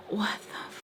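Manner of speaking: cut-off whispered curse; whispered disbelief. Retold or imitated. Teller piecing everything together, whispered disbelief. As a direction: mouth it under your breath, soft and voiceless.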